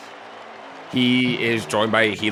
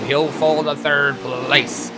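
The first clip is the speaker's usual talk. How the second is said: normal talking voice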